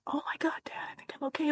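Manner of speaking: acted whisper, very soft; hushed aside. Daughter tells dad she can stay, acted whisper. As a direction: whisper it privately, as if only Dad should hear. Confiding quietly to dad, retold.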